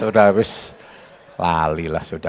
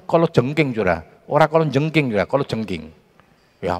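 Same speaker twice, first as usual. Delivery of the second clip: usual speaking voice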